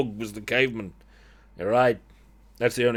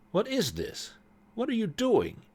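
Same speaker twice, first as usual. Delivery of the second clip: much higher, falling questions; startled demand; 12 semitones higher, 4 dB quieter than usual. Acted. Brother catches sister at witchcraft, startled high-pitched demand.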